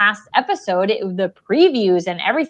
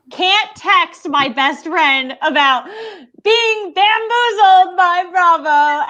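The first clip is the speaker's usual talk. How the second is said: frustrated exclamation; 7 semitones higher, slower than usual. Real, not acted. Frustrated waiting for friend to watch.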